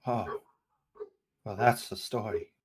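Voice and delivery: ordinary talk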